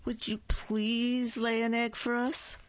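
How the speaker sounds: high sweet coaxing ask; sweet pleading. A narrator's reading. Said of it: "giving her a big hug".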